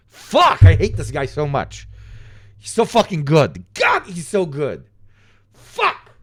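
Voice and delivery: loud, high exasperated swearing; frustrated outburst. Real, not acted. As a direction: vent in angry disbelief. Frustrated ranting about rival.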